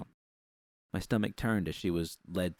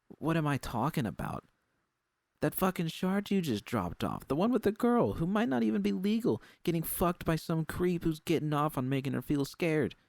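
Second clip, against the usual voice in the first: raised, higher furious voice, climbing; furious outburst; 10 semitones higher, 3 dB louder than usual. Acted. Judy yelling at Susie about the girl, furious. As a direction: spit it out in rising fury.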